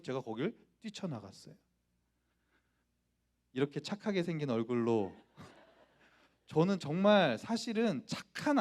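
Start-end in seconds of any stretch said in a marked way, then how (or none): none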